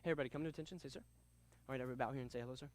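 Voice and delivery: low, soft monotone, no enthusiasm; dull, quiet demo of a boring teacher; unenthusiastic instructor. Shown as a demonstration. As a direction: mumble the class opening without any energy. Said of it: "I sounded like this right here".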